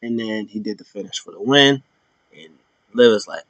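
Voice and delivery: ordinary talk, nothing marked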